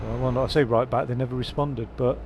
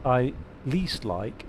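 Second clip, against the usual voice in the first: ordinary delivery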